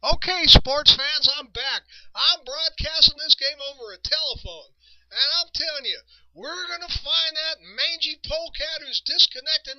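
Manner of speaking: high, loud broadcaster rant building to a cry; hammed-up folksy announcer losing it; irate Monty Moore. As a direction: play the angry announcer threatening the culprit. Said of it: "He says".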